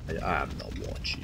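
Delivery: lightly voiced orc war chief; a hint of a war chief; mimicking orc war chief. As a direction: echo the game unit, a little low. Mimics game unit's line.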